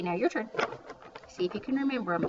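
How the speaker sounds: ordinary talk, nothing marked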